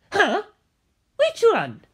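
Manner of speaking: high surprised question; ask, high and baffled; puzzled Bucciolati. A narrator's reading. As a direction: friend confused which George is calling.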